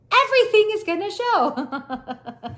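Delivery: high, slow laughing words. Real, not acted. Telling viewers about the delivery, laughing.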